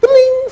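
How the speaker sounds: very high short bling like a chat alert; messenger bling. Retold or imitated. Imitating the instant messenger alert, high bling. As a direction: chime it like a message tone. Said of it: "Next day".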